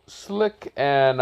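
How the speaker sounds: ordinary delivery, nothing marked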